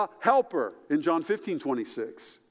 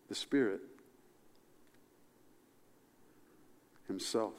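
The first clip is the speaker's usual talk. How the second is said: the Spirit himself, soft and lower; hushed; 6 semitones lower, 8 dB quieter than usual. Real, not acted. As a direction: linger quietly on himself. Reverent repeat.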